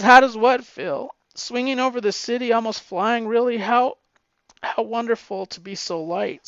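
high voice, moved while quoting; choked up. Real, not acted. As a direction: read the quote on the verge of tears. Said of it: "I'm gonna cry".

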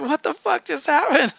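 high, incredulous squeal of confusion; mock disbelief. Comic reader baffled by a sudden romance twist, high incredulous squeal. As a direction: sound utterly baffled by the twist.

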